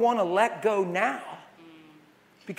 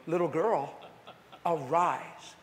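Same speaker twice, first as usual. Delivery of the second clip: gentle command; 3 semitones lower, 5 dB quieter, slower than usual. Retold or imitated. Gentle take against loud take.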